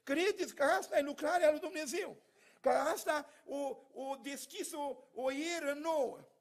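rising pulpit fervour; fervent preaching. Acted. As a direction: urge the church to believe. Preaching to the congregation.

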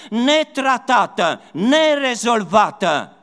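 high, loud fervent listing; preaching at full force. Acted. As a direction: cry out each wound in turn. Preaching burst, raised voice.

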